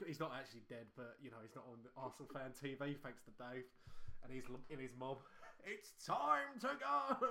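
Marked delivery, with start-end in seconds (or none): 5.6-7.3 slow, drawn-out, higher and louder old man's mock call; a fond parody of the old fan's catchphrase. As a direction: drag it out like the granddad in the clip. Host imitating the clip's old man.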